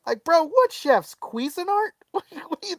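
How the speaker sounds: high-pitched, disbelieving comeback; mock incredulous rant. Diner answering the owner, high comic disbelief. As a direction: question it in a high, offended voice.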